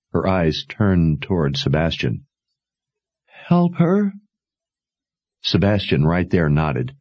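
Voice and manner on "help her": lightly voiced dying mother, urgent and tender; a hint of a dying mother pleading; faintly voiced mother urging her to run.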